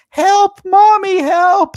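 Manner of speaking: high, loud mock child's scream; piercing mock cry for help. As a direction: scream for mommy like a terrified kid. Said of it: "still very capable of screaming".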